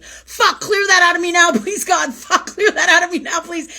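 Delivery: high, loud crying plea to God; frantic retold prayer with a laugh; desperate plea. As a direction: beg in panic, half laughing. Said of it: "And I was like".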